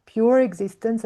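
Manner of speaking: strong lift on "pure"; stress on pure. Real, not acted. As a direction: hit "pure" hard, then ease off. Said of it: "I emphasize the word pure".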